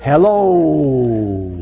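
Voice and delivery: long theatrical hello; thespian voice. Shown as a demonstration. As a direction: project it from the stage. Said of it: "I have a thespian voice".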